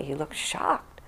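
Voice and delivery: normal talking voice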